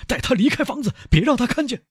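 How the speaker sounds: very high, urgent command; strained, high-pitched urgency; frantic order. A narrator's reading. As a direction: order the servants in a panic. Frantic father ordering servants.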